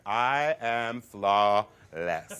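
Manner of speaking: very slow, drawn out and loud; grand self-admiration. Acted. Boy admiring his sticker manicure, grandly self-satisfied. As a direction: savor each word like a diva.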